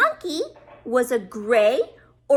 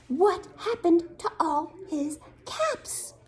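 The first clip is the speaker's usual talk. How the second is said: plain reading voice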